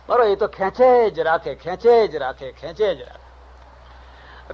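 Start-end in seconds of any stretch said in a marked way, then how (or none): 2.5-4.5 drawn-out, very slow breathy whisper. Shown as a demonstration. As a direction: let the words trail off like endless pulling. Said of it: "કેટલાક છે કે બળદ જેવા છે".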